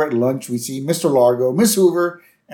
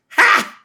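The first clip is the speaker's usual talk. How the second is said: one loud, high acted "Ha!"; mock laugh; 6 dB louder than usual. Teacher reacting to principal's secret, loud mock laugh.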